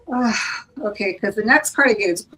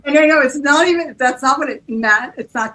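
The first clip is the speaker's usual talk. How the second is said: words pushed out through laughter, louder; laughing while talking; 4 semitones higher, 7 dB louder than usual. Real, not acted. Reader laughing as she explains the misheard lyric. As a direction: explain it while still cracking up.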